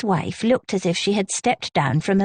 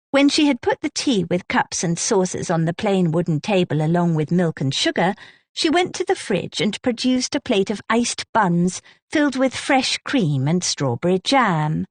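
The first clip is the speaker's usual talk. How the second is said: usual speaking voice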